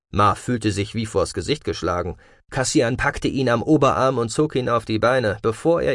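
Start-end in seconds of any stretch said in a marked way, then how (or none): none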